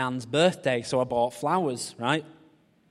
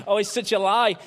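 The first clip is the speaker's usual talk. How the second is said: laughing through the words; 8 semitones higher, 5 dB louder than usual. Real, not acted. Preacher laughing at his own cage fighter joke. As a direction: crack up at his own fib.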